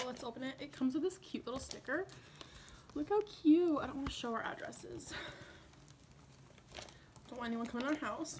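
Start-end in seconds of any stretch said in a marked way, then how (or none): 3.8-8.4 soft unvoiced whisper. Real, not acted. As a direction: whisper it so no one hears. Hiding addresses on card.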